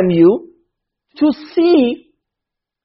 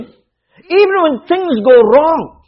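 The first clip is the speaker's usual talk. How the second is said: higher, slow, drawn-out; raised preacher's call, slowed right down; emphatic, slow preaching; 7 semitones higher, 3 dB louder, much slower than usual. Real, not acted. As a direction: stretch each word as a warning.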